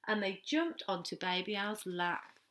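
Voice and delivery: lively picture-book reading for little ones; story narration. Acted. Teacher reading a story to the reception class, animated storytelling. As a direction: read it out like storytime on the carpet.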